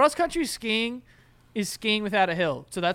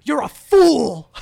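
mock-indignant shout, drawn out and pitched up; Long Island scolding; 6 semitones higher, 11 dB louder, much slower than usual.

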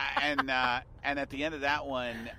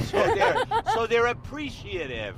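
much higher, louder hammed-up ad voice; mock commercial announcer; 10 semitones higher, 7 dB louder than usual. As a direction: oversell it like a cheesy TV spot.

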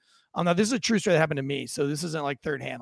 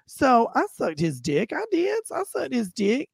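theatrical storytelling with swooping pitch; nonchalant suspect; 6 semitones higher, 3 dB louder than usual. Retold or imitated. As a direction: imitate an unbothered man explaining himself to police. Plays suspect telling his side.

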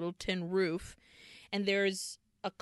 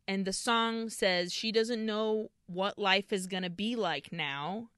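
normal talking voice

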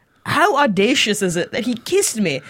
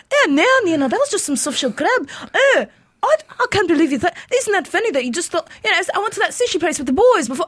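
imitating Scottish boyfriend; 8 semitones higher than usual. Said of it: "I dated this Scottish guy".

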